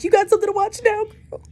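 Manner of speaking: high, loud, fast teasing; cheeky high call-out; playful sass. Real, not acted. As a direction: tease her friend with sass. Teasing the absent friend.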